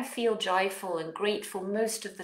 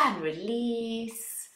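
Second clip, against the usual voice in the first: slow release; 2 semitones higher, 4 dB quieter, much slower than usual. Shown as a demonstration. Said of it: "guided breath release".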